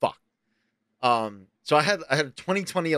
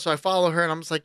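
ordinary talk, nothing marked

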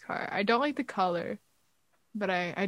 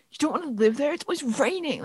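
lightly mocking commenter voice, a little raised; mimicking critics; 4 semitones higher than usual. Co-host imitating people coming at her online, mild mocking voice.

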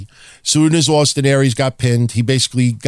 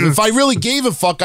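normal talking voice

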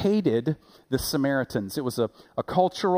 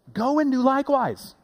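voicing Jesus; 10 semitones higher than usual. Jesus's closing command.

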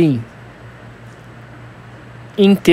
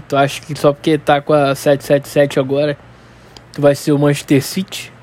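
ordinary talk

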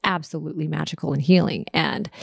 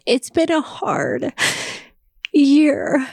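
crying; 7 semitones higher than usual. Real, not acted. Tears over missing Dave.

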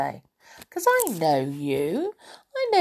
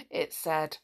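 ordinary delivery

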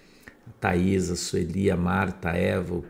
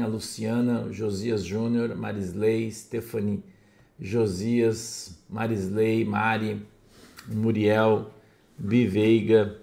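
usual speaking voice

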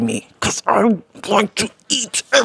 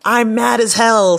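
louder, higher movie rant; mock movie fury; mad as hell quote; 6 semitones higher, 6 dB louder than usual. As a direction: belt the famous line. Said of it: "speech from the Network movie".

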